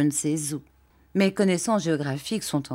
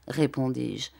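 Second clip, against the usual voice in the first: usual speaking voice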